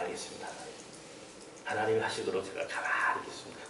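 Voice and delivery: bow your head and answer meekly; a quiet confession to God; humble reply to God. Retold or imitated. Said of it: "고백했어요".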